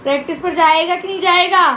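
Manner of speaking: impatient, forceful question; insistent demand. Real, not acted. Repeats question, losing patience.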